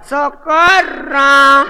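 high, drawn-out wail for help; hammed-up panic, stretching out the last words; mock drowning cry. Drowning man calls for help.

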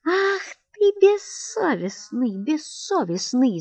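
furious wife crying out; screaming at husband. A narrator's reading. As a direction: scream the reproach at him. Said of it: "закричала она".

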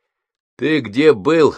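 put-on slow, stern demand from the older brother; strict question. A narrator's reading. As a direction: ask it sternly, like a boss. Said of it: "строго спросил Пашка". (строго, медленно)

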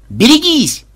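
high, urgent call; warning shout. Acted. Programmer warning his partner of a grenade, shout.

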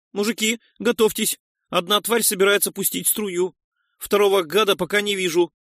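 high-pitched lookout's shout; urgent warning. A narrator's reading. Noise warns the men of an incoming stream, shouting. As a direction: call out the threat, pitched high throughout.

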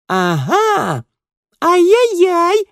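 very high, loud teasing squeak; squeaky little girl. A narrator's reading. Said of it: "запищала другая". (писклявый детский голос)

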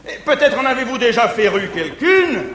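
ordinary delivery

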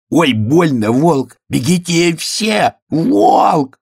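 high panicked cry, last word drawn out; задыхаясь. A narrator's reading. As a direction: cry out in pain and alarm. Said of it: "задохнулся медвежонок". (отчаянный крик медвежонка)

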